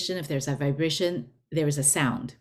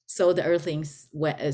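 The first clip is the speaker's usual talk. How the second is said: ordinary delivery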